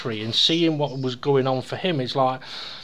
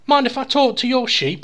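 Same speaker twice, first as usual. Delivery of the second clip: high-pitched polite request; joke's Englishman; 9 semitones higher, 4 dB louder than usual. Acted. Englishman asks Welshman about his sheep, high polite request. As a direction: ask permission, pitched right up. Englishman's setup before punchline.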